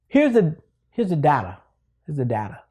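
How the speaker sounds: "data" said as DAH-tuh; DAH-tuh pronunciation. Shown as a demonstration. Teacher trying out the word, "data" as DAH-tuh. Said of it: "Now I'm saying dada".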